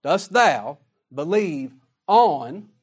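slow, with on drawn out and rising; stress on ON. Shown as a demonstration. Preacher stressing Jesus's exact words, slow with on stressed. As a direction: lean into the word on, slowly. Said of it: "not in".

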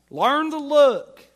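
loud, high, slow pulpit shout; loud command. Real, not acted. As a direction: shout it out slowly. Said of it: "climactic command to congregation".

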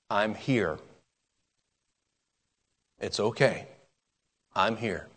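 put-on soft, hushed parent soothing a child; tender comfort. Parent soothes a child crying at night, tender and soft. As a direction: hold them close and whisper-soft reassure.

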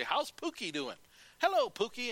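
put-on high-pitched playful greeting to an unborn baby; aunt cooing at belly. Aunt greeting the unborn baby, playful high voice. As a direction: imitate a doting aunt talking to the bump. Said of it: "she'd always say".

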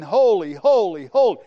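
loud ringing cry, rising on the last; crying out holy. Acted. Preacher voicing the beasts crying before the throne, loud. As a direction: cry it out like heavenly creatures. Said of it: "and they're crying".

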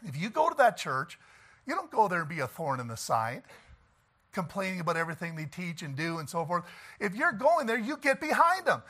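ordinary talk, nothing marked

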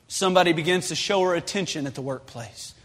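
ordinary talk, nothing marked

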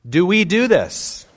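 high and loud challenge; pointed question. Real, not acted. Preacher challenging the congregation, high and loud. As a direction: press the question on them.

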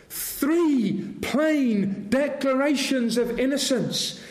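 usual speaking voice

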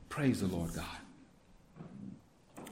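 very low, slow, soft murmur; hushed praise. Real, not acted. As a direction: breathe it out quietly in reverence. Quiet low aside praise.

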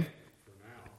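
breathy aside, under the breath; whisper. Real, not acted. Teacher whispering an aside to the class. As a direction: murmur it just to yourself.

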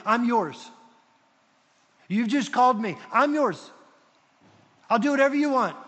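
high, loud, fervent declaration; eager surrender. Retold or imitated. Paul offers himself to the Lord, eager surrender. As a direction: pledge yourself at full voice.

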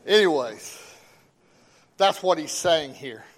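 loud, emphatic sermon delivery; forceful preaching. Real, not acted. Preacher reads scripture and exhorts the congregation, forceful preaching. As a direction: preach it out, punching key words.

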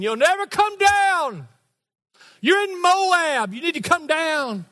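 high, loud, slow teasing call to the balcony; mock-scold the man up top. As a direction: pastor calling out to Randy in the balcony, teasing. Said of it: "I'm teasing".

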